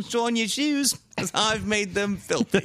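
hammed-up high-pitched character voice; mock shoeshine character. Playing a shoeshine character in the bit.

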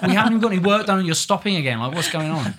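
fast, high, loud mock American rant, laughing; American exasperation. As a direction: rattle it off like an impatient Yank director. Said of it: "So she's just like".

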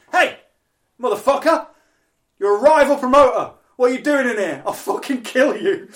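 high, very loud mock threat; aggressive greeting shout. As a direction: bellow across a room at a friend. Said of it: "he just shouts at me from across the room".

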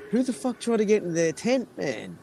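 imitating his puzzled self; high incredulous ask; baffled question. Retold puzzled question to himself.

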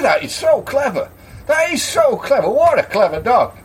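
high-pitched gushing wonder; marvelling customer. Acted. Customer admires the card-playing dog to landlord, marvelling. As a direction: gush it high, awestruck by the dog.

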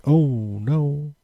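hammed-up sleepy commentary; mock soft commentary. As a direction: mimic the commentators barely speaking. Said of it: "talking very softly".

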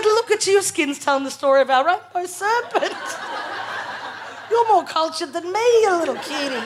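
laughing, teasing her freckled partner. Retold or imitated. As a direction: tease him fondly through laughter.

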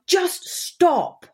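a high, urgent retold plea to stop; begging him to back off, voice up high; desperate plea.

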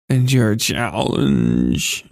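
very slow, deep, menacing; slow low demon. A narrator's reading. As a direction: drag the question out ominously. Demon girl asks challenge.

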